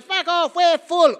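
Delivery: high, loud yell; bogan yell. Pretend. Caped patriot yelling at arriving refugees, high and loud. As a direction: bark it at the boats. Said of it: "come to Australia".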